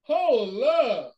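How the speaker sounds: loud, high, excited shouts of hold up. Real, not acted. Watching with a friend, shouting hold up in excitement. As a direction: yell hold up at the screen, all worked up.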